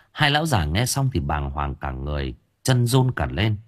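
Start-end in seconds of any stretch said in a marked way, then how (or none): none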